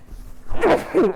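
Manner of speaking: a real loud sneeze; sudden sharp sneeze; sneezing. Real, not acted. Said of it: "I'm gonna sneeze again".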